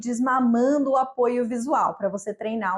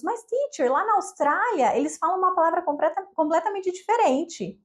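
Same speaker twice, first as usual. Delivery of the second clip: higher-pitched voice of a student; puzzled student; 5 semitones higher than usual. Acted. Students telling their teacher about Australian English, higher-pitched student voice. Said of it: "Já escutei de vários alunos".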